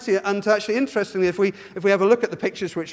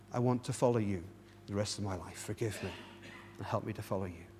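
quiet prayer; 8 semitones lower, 11 dB quieter than usual. Shown as a demonstration. Preacher models a prayer for the congregation, soft and hushed. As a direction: pray it gently, head bowed.

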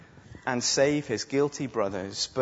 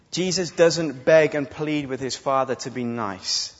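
usual speaking voice

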